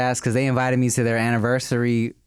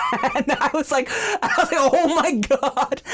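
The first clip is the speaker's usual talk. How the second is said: laughing; 13 semitones higher than usual. Real, not acted. Dad laughing at toddler's cuss.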